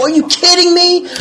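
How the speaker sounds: high-pitched disbelief; incredulous. Retold or imitated. Reacting to the tow fee, high incredulous squeak. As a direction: squeak out your outrage at the price. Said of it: "I go".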